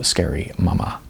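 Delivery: ordinary delivery, nothing marked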